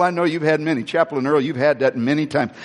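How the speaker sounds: ordinary delivery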